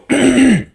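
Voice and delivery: exaggerated throat clear before a put-on voice; mock throat clear. Gamer bracing to greet a girl, mock throat clear. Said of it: "all of a sudden guys go".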